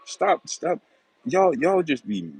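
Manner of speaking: high-pitched, slowed, disbelieving stop; exasperated protest. Real, not acted. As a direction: cut them off like they're talking nonsense. Arguing with chat commenters.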